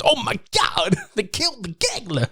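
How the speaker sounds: high, wailing Travolta-style grief; John Travolta impression. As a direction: wail like Travolta in despair. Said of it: "Sound like John Travolta".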